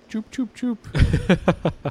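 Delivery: high, slow choop sounds to a cat; kissy clicking noises for a cat; cat-calling clicks. Shown as a demonstration. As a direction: click your mouth to call the cat. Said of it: "I just go like".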